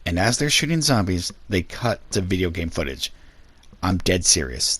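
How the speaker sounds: ordinary talk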